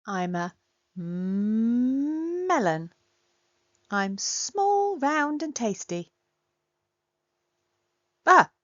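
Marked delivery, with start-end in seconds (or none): 3.9-6.1 higher, chirpy boasting; high proud claim. Acted. Pretends to be a melon.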